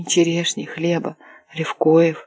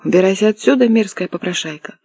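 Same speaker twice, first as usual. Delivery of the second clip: usual speaking voice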